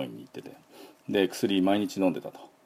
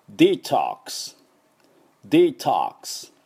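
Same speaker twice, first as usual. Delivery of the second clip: slow American English demo of "デトックス"; American English accent; slower than usual. Shown as a demonstration. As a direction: say "デトックス" as English "dee-tahks", wide open O. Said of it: "ネイティブの発音でやってみます".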